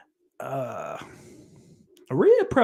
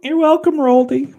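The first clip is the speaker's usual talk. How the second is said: loud, much higher, gleeful; excited call-out; 14 semitones higher, 11 dB louder than usual. Real, not acted. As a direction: shout the welcome triumphantly. Calling out to a chatter.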